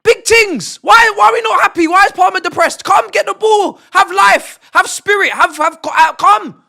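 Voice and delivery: loud, high yelling at the screen; frustrated shouting. Real, not acted. Streamer yelling at his football team, loud and high. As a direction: bellow at the players to wake up.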